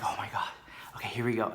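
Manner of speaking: breathy nervous whisper. Retold or imitated. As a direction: whisper it to calm yourself before your turn. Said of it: "And I was like".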